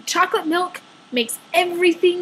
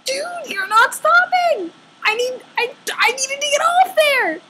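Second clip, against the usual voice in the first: frustrated plea; 9 semitones higher than usual. Retold or imitated. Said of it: "And so I'm like".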